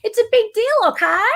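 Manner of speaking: high, loud mock protest; high and emphatic, playing it up; mock exclamation. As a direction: exclaim it big and high-pitched. Repeats line in mock voice.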